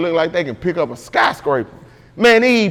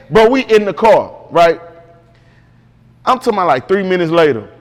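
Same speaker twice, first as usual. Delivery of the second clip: ordinary talk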